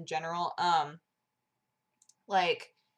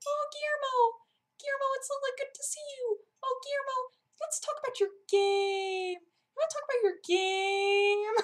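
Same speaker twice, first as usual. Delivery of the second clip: squeaky, super obnoxious fawning; obnoxious high interviewer voice. Pretend. Joking interview of Guillermo, obnoxious squeaky voice. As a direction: gush very high, stretching the final words. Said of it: "talk in this voice the entire time".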